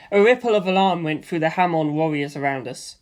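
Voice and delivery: ordinary delivery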